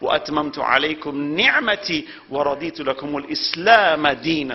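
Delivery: quick chanted Quranic opening; Quran recitation. Acted. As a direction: recite the verse in a swift chant.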